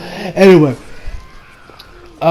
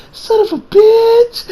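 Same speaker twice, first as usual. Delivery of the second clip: high-pitched exclamation; slower than usual. Retold or imitated. Voicing Koichi's exasperation.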